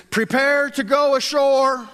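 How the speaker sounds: high, loud sailor's call; shouting from crow's nest. Shown as a demonstration. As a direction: bellow it like a ship's sailor. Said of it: "Let's say that in your best sailor voice".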